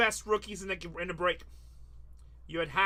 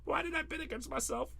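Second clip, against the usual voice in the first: mock crying; 8 semitones higher, 4 dB quieter than usual. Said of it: "Matt is crying right now".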